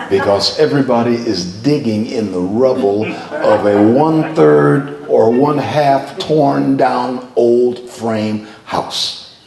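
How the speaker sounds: ordinary delivery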